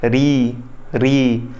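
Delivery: री drawn out low and clear; vowel demo. Shown as a demonstration. Teacher models री for students, drawn out and clear. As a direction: say री slowly so the class can copy. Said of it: "अब मेरे साथ बोलो".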